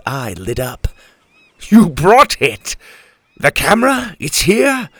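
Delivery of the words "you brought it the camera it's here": loud, high burst of delight; overjoyed captain, pitch leaping up; excited. A narrator's reading. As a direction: exclaim in thrilled disbelief. Captain delighted the camera has arrived.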